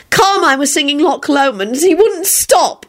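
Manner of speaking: a high drawn yell. Acted. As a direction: shout the news out. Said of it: "Rosie yelled".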